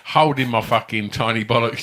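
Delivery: usual speaking voice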